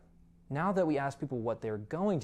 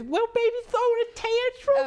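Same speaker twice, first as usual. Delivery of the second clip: put-on much higher, loud cooing baby talk; mock baby talk; 9 dB louder than usual. Host mocks the grown guest, loud high baby talk. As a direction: coo at him like he's a toddler.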